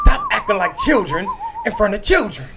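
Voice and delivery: screeching high, loud climax of an impression; Ray Lewis. Comic ending his athlete impression, shrieking in mock outrage. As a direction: explode into an overblown righteous scream. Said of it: "This is my Ray Lewis impression".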